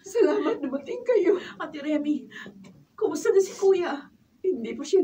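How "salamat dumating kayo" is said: crying relief, voice higher; tearful thanks at the hospital. Acted. Greeting at hospital, distressed.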